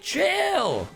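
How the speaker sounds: usual speaking voice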